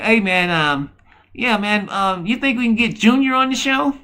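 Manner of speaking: imitating a meek little voice; soft little voice. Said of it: "You got that little, voice that".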